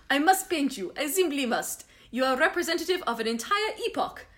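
badly faked German artist; German accent sliding into French; attempted German accent. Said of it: "a little German accent, but it's probably gonna end up French".

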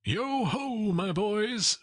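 jolly master's high, sustained hail; Fezziwig. A narrator's reading. Master hailing his boys, high and jovial. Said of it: "said Fezziwig".

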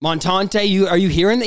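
ordinary delivery

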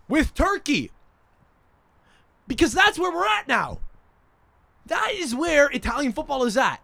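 high-pitched exasperated outburst; exasperated rant. Real, not acted. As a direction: let the voice leap up high in indignation. Exasperated rant about co-hosting.

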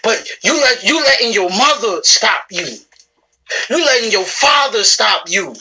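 ordinary talk

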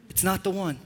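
quietly puncture the fantasy; deadpan rebuttal. Acted. Deadpan rebuttal of acted teenager.

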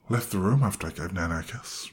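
ordinary delivery

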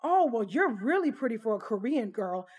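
exaggerated singsong gushing, swooping pitch; mock a tipsy guest's overdone compliment; imitated gushing compliment. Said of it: "She continued on to say".

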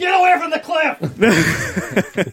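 high, loud, drawn-out mock yell; imitated panicked yell. Friend calling for their safety, mock panicked yell. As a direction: yell a drawn-out warning, hammed-up. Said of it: "calls for our safety that John would throw out".

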